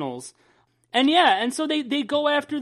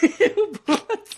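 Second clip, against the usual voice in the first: laugh; 11 semitones higher than usual. Real, not acted. Reacting to cohost's joke.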